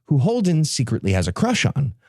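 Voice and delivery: usual speaking voice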